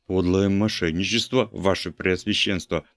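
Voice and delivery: ordinary delivery, nothing marked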